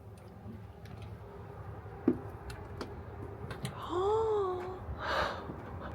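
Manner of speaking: long, high rising gasp of wonder; drawn-out high gasp; long awed gasp. Real, not acted. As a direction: gasp at a stunning view. Sees the view.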